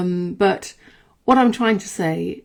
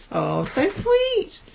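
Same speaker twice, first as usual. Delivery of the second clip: cooing; 13 semitones higher, much slower than usual. Real, not acted. Cooing over a dog picture.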